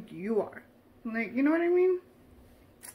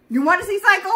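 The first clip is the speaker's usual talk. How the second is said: higher, louder teasing threat; mock threat; 7 semitones higher, 11 dB louder, much faster than usual. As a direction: belt it like a playful menace.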